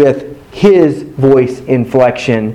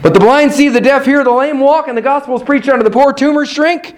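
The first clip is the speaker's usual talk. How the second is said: higher, fast and driving; fired-up preaching; 10 semitones higher than usual. Acted. Preacher rousing the congregation about miracles. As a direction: preach it out with rising fire.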